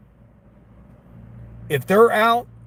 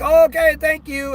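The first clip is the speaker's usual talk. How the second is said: high, louder mock reply; mock customer; 10 semitones higher, 4 dB louder than usual. Driver imitating the customer's partner at the door, high mock voice. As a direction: parody the man answering the door.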